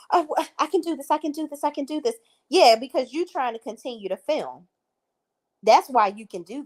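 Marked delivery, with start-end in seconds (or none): 0.1-2.2 high, whiny mock self-pep talk; imitating a castmate talking herself up; mocking Danielle. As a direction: mimic her repeating it to herself, pitched up. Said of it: "because she's like".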